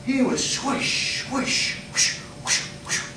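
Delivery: breathy whooshing sword noises; swish sound effects. Retold or imitated. Speaker mimicking the kid's sword swishes. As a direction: imitate a stick slicing the air.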